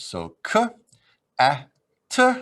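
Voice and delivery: k, a, t spoken as separate sounds; sounding out words. Shown as a demonstration. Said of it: "if I want to spell it out".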